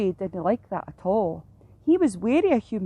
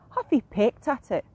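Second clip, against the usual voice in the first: ordinary delivery, nothing marked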